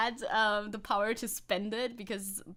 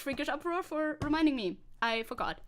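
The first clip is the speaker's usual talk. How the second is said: ordinary talk, nothing marked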